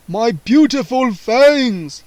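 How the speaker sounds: slow, loud vampire lament; cry. A narrator's reading. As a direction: mourn the lost fangs. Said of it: "he cried".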